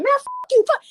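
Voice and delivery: high-pitched retold cussing-out; fly off the handle, imitating a hothead; mock angry outburst. Acts out quick cancer outburst.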